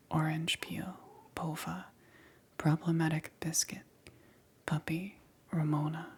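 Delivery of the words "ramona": usual speaking voice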